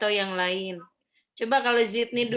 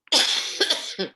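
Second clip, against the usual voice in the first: cough; 6 semitones higher, 3 dB louder than usual. Real, not acted. Teacher with a cough.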